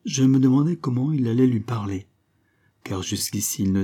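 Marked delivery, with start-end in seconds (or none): none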